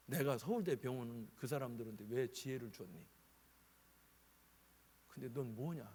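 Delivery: soft, low questioning voice; a quiet divine reproach; lightly voiced God chiding his son; God's quiet rebuke. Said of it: "그러시대".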